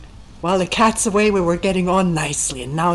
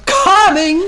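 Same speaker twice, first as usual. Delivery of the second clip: loud, high call back; shouted reply; 12 semitones higher, 12 dB louder than usual. Retold or imitated. Attendant shouting back to the impatient abbot, loud and high. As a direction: fling the answer back at full voice. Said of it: "so now there's a shout".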